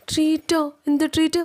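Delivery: high-pitched incredulous questions; taken aback, voice jumping high; startled disbelief. Acted. Acted startled reply about a treat.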